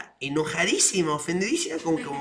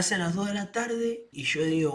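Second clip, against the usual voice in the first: normal talking voice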